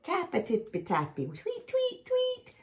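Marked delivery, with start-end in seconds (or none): 1.3-2.5 high little chirp; bird tweet. Acted.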